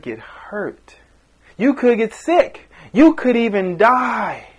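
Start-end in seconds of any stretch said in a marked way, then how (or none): none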